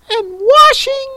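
very high tearful creature voice; Mock Turtle voice. A narrator's reading.

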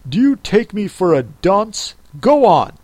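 loud, sharp rebuke. Acted. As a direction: snap it at him. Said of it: "said the king sharply".

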